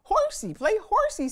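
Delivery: put-on high, loud little-girl plea; small cub begging her papa for a horsey ride; little Honey. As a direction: beg like a tiny cub wanting a ride. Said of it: "said Honey".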